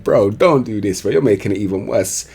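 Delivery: usual speaking voice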